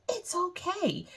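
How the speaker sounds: high, soft reassurance; reassuring. Real, not acted. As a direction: lift the voice high and soft to give gentle permission. Permission to close eyes or not.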